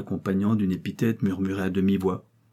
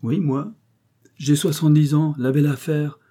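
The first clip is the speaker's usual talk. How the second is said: high, loud, spirited boast; lively; 6 semitones higher, 5 dB louder than usual. A narrator's reading. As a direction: brush off age with vigour. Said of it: "surpris de cette vivacité". (avec une vivacité fanfaronne)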